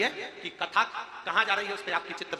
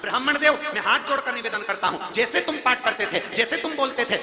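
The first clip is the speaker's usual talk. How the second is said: loud, higher fervent sermon voice; full-force sermon, raised high and loud; preaching at full force; 6 semitones higher, 7 dB louder than usual. Acted. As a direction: lift it up and proclaim the devotion.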